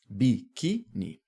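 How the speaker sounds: "Biquíni" in three slow, separate syllables; bi, qui, ni: pause between the syllables of "Biquíni"; separação silábica. Shown as a demonstration. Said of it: "É o qui". ("Biquíni" devagar, sílaba por sílaba)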